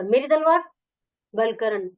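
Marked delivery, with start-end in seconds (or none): 0.0-0.6 sudden high, louder cry of surprise; चौंककर, ऊँची आवाज़ में; startled. A narrator's reading. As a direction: jolt up as if the sword just vanished. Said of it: "Taimur चौंक कर".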